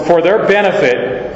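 usual speaking voice

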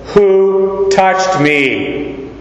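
very slow, high, drawn-out "WHO... touched me?"; emphatic. Acted. Christ speaking over his disciples, emphatic, drawn-out high WHO. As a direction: stretch WHO long and high, then land "touched me" deliberately. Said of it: "since Christ is so emphatic".

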